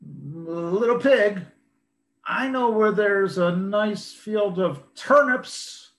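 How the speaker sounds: slow, coaxing wolf voice; sly wolf. Acted. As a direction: tempt the pig sweetly.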